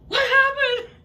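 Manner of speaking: high, loud panicked yell; shouting across the water in fright; alarmed shout. Retold or imitated. Friend surfaces screaming.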